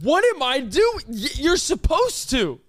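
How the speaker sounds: high, loud indignant protest; exasperated outburst. Real, not acted. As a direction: voice leaping up in exasperation. Exasperated cohost defending himself.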